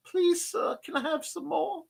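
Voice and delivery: small, high-pitched pleading voice; mock begging. Streamer begs viewers for help, mock pleading.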